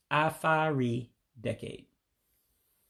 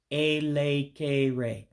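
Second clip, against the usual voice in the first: "elekere" slowly, syllable by syllable; slow, careful "elekere", ay-lay-kay-ray; slow demonstration; much slower than usual. Shown as a demonstration. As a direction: draw out each E like an English A. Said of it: "So I'll do it slowly".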